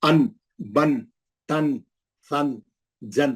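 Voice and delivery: slow, drawn-out ان بن تن سن جن with a clear nasal n; teacher sounding out ان بن تن سن جن; tanween drill. Shown as a demonstration. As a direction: read ان، بن، تن، سن، جن slowly, one by one like a Quran teacher. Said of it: "کیسا پڑھیں گے؟".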